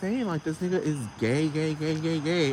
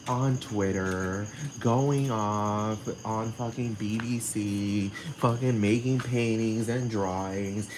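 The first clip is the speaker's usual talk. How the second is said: slow, emphatic listing; 4 semitones lower, slower than usual. Real, not acted. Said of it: "emphatic slow list".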